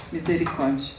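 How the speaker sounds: ordinary talk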